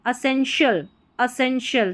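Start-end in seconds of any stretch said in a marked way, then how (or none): none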